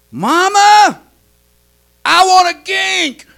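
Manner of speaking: put-on high, slow child's plea; toddler calling mama. Toddler calling his mother again for water. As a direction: call out sweetly to mama.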